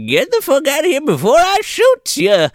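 high granny threat; threatening old lady. Retold or imitated. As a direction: threaten to shoot like a cranky old woman. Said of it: "she's like".

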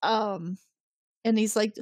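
ordinary delivery

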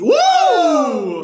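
high, loud, drawn-out whoop; excited whoop. Real, not acted. Contestant reacting to another's right answer, excited whoop. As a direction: cheer it out in amazement. Cheering a correct quiz answer.

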